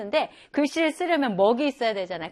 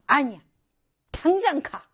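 loud, higher stern refusal; stern mother; 4 semitones higher, 4 dB louder than usual. Shown as a demonstration. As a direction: send him away firmly. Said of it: "이렇게". (단호하게 거절하는 어머니)

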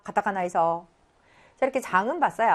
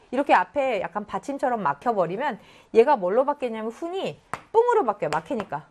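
normal talking voice

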